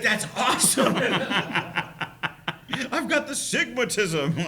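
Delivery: thrilled to be a sigma; excited kid. Retold or imitated. Son delighted by his diagnosis, excited and laughing. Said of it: "He was excited for it".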